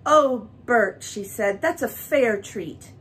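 loud, warm delight, slow on the last words; admiring delight. A narrator's reading. Mary praising Bert's painting, delighted. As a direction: make him feel it's a masterpiece. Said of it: "by the way she said it".

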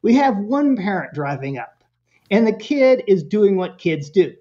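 ordinary talk, nothing marked

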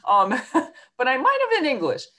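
bright laughing jab, fast and high. Real, not acted. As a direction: burst of high, loud, quick laughter into the line. Joking about her own subject.

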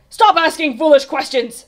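high, loud royal rebuke; angry snap. A narrator's reading. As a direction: snap impatiently at the page boy. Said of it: "snapped the king".

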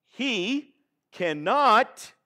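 "cannot" drawn out very slowly and leaned on; "cannot" stretched slowly for call and response; stress on cannot. Shown as a demonstration. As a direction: hold "cannot" long so the congregation joins in. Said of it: "somebody say with me".

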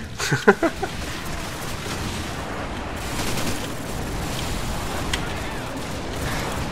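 long airy high-pitched giggle; high breathless giggle. Real, not acted. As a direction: giggle helplessly at your own mess. Laughing at his own play.

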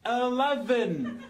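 laboured, elongated delivery for a machine; slow, drawn-out word. Acted. Man repeats himself to a voice-controlled lift, slow and drawn out. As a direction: stretch the word out very slowly.

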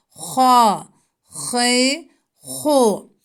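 "Ha, hi, hu" with the breath flowing out through the mouth; breathy H syllables. Shown as a demonstration. Teacher shows pupils the airy H, breath streaming. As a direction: let the air stream on "Ha, hi, hu". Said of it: "nefesi ağzımızda akıtarak çıkarıyoruz".

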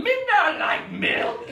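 put-on high, slow broken-English toddler refusal; grumpy toddler. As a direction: drag it out like a stubborn toddler. George refusing again.

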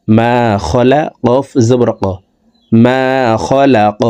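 ordinary talk, nothing marked

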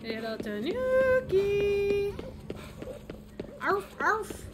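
cooing. Real, not acted. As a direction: call the little animal sweetly. Cooing at a cute animal.

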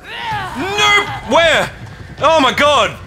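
high, loud startled yelps; real jump-scare panic; panicked shouting. Real, not acted. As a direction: cry out as it lunges at you. Monster jumps at player.

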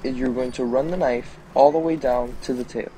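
ordinary talk, nothing marked

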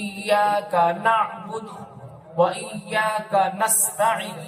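very slow, ইয়াকা and নাস্তাইন separate; words kept apart. Shown as a demonstration. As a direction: recite each word distinctly, not কানাস্তাইন. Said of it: "আপনারা আলাদা রাখবেন". (আলাদা আলাদা করে)

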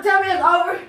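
usual speaking voice

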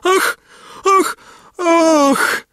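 three very high, separate "ach" cries; swooning high-pitched cries, the last longest; princess's shriek. A narrator's reading. Princess crying out before she faints, shrieking. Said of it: "Księżniczka krzyknęła".

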